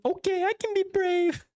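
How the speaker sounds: put-on very high kid voice, working up courage; little kid bravely agreeing; child voice. Child reassured by the player, very high and trying to be brave. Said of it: "I hate my child voice".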